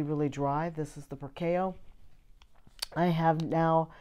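ordinary talk

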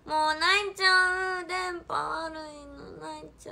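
high, slow, sing-song fake wail; mock crying. Streamer whining to viewers about the bad connection, mock sobbing. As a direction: whine like a baby about to cry. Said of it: "赤ちゃん帰りしちゃう".

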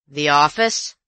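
loud gruff echo; scornful colonial. Acted. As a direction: scoff at the word. Visitor echoes scornfully.

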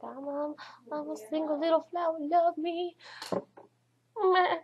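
high, climbing put-on little voice; hammed-up high-pitched plea for love; mock lovelorn plea. Vlogger bemoaning being the only single one, mock lovelorn.

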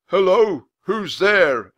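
loud, high call down the hall; man calling out to unseen visitors. Acted. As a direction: call to strangers from upstairs. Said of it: "a man called".

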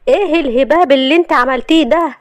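high-pitched annoyed outburst; irritated, rattled. Acted. Husband jolted awake by wife's dousing, irritated. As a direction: demand an explanation, startled and cross. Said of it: "وقال وهو متنرفز".

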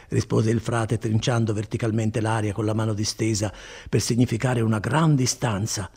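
plain reading voice

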